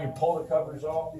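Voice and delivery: usual speaking voice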